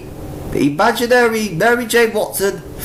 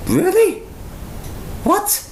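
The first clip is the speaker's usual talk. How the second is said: shocked disbelief; 13 semitones higher than usual. Real, not acted. Reacting to comic panel.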